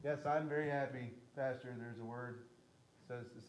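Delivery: a lightly voiced, hushed mock reply from the pews; mock congregant. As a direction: play a cheeky churchgoer answering back, softly. Pastor voices a listener's reply.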